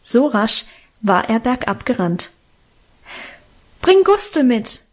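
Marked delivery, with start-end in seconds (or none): 3.8-4.8 calling after him. A narrator's reading. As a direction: shout it after someone running off. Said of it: "rief ich ihm". (ihm nachrufend)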